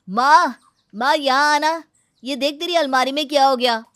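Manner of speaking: loud high call across the house to mother-in-law. Acted. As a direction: shout for her to come and see. Daughter-in-law calls her mother-in-law.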